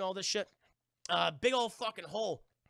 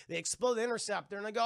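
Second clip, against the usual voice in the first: usual speaking voice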